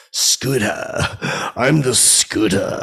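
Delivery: a slow, lightly put-on Scooter voice; Phil Rizzuto impression. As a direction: drawl out the name like the old broadcaster. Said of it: "I used to do the impression".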